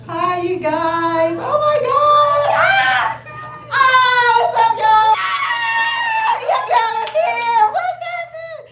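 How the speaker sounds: squealing, loud, high hello to the room; excited greeting. Real, not acted. Host greeting her community with a loud, high, excited squeal. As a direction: greet everyone like you can't contain yourself.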